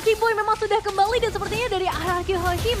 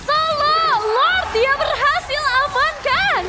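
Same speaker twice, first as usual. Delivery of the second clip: excited; 7 semitones higher, 6 dB louder, much slower than usual. Real, not acted. Caster hyping comeback play.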